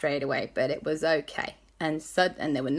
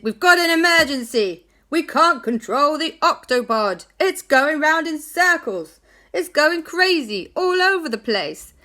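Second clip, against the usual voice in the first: much higher, louder frantic voice; panicked emergency; 10 semitones higher, 8 dB louder than usual. Acted. Captain Barnacles reporting the spinning Octopod, loud and panicked. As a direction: shout it out in alarm, dizzy.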